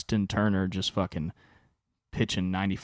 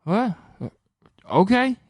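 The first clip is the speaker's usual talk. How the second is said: baffled what okay; 12 semitones higher, 5 dB louder than usual. Retold or imitated. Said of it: "he just goes".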